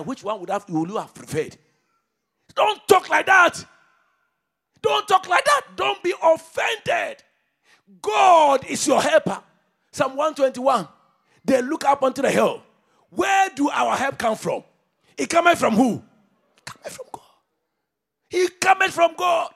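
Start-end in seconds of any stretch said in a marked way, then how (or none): 2.6-16.0 high, loud shouted preaching; fiery preacher shout; preaching at full force. Acted. 16.6-17.2 sudden whisper amid shouting; whispered echo of the answer. Acted. 18.3-19.5 high, loud shouted preaching; fiery preacher shout; preaching at full force. Acted.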